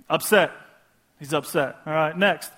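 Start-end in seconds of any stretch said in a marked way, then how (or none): none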